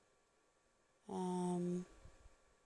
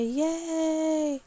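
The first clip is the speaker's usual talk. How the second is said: excited cheer; 9 semitones higher, 6 dB louder than usual. Real, not acted. Happy news about seeing Jorge.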